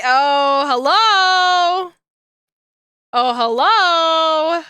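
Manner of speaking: slow, loud sultry greeting; flirty hello. Pretend. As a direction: purr it at the screen.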